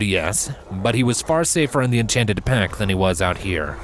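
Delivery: ordinary delivery, nothing marked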